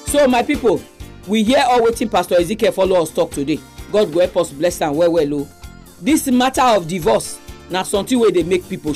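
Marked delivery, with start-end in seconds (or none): none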